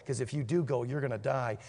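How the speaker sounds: low, soft solemn warning; grave warning. Retold or imitated. Micaiah warning Ahab he will die, low and soft.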